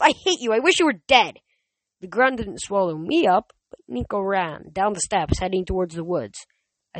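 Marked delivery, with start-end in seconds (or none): 0.0-1.4 high, loud hateful outburst; furious yelling. A narrator's reading. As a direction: yell it in bitter rage. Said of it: "he yelled".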